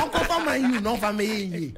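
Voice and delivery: ordinary delivery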